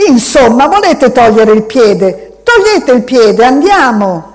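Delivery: put-on high, loud childish anger; a small boy trying to sound menacing; irritated, almost threatening. A narrator's reading. Boy to the man, irritated high demand. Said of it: "irritato... quasi minaccioso".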